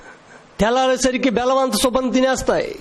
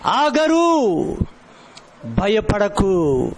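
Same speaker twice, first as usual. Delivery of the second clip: slow, tender reassurance; gentle comfort; 4 semitones lower, much slower than usual. Acted. God gently calling frightened Hagar, slow and tender.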